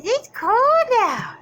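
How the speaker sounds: high, slow, lightly voiced dog; voicing the dog. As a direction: give the dog a light put-on whine. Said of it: "He says".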